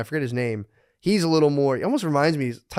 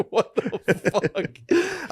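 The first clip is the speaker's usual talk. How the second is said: drawn-out, high, laughing what the fuck; a slow, high, cackling what the fuck; laughing disbelief; 10 semitones higher, much slower than usual. Retold or imitated. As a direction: stretch it slowly through a laugh, baffled. Laughing, mock-baffled reaction.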